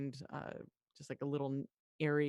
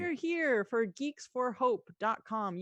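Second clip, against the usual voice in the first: charity announcement; 5 semitones higher, slower than usual. Real, not acted. Announcing the fundraiser.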